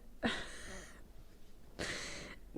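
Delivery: soft whispered laugh. Real, not acted. Laughs about the mud cake guy.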